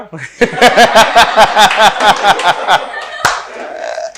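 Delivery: helpless rolling laugh that tails off; long real laugh. Real, not acted. Teacher must speak nicely here, bursts into long laughter. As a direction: crack up in a long run of chuckles. Teacher cracks up at own remark.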